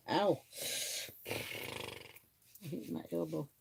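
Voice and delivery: slow hushed pained mutter. Real, not acted. Owner nursing her elbow after hitting it. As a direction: wince through the words.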